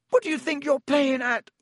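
high, strained outburst; angry shout. A narrator's reading. Justin angry and scared at Harry, angry shout. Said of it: "he shouted".

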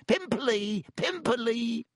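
high taunting yell; jeering yell. Acted. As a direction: shriek the taunt gleefully.

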